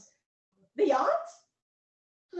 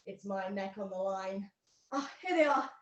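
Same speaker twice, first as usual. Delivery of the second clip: normal talking voice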